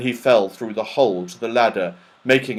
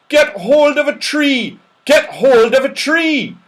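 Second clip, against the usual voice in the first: shouting warning; 14 semitones higher, 7 dB louder than usual. Acted. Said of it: "shouted the people round about".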